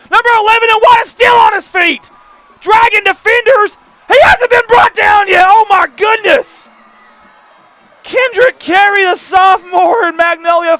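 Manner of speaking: high, loud, stretched shouting call; excited play-by-play shout. Real, not acted. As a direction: call the long run at full cry. Big run play-by-play.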